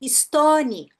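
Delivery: "stone" as Brazilian "istóni", added i at both ends; loud Brazilian-accented "stone"; mispronounced stone. Retold or imitated. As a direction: imitate a learner saying "istóni". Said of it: "pessoas o tempo todo falando".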